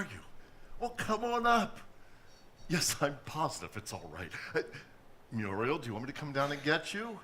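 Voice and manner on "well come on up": cheerful invitation. Acted. Inviting her up over phone.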